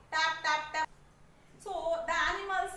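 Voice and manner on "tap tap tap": loud, spaced tap tap tap; tapping sound imitation. Shown as a demonstration. Teacher showing pupils the tapping sound, loud and even. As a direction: knock the word out like tapping on a shell. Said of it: "See this textbook".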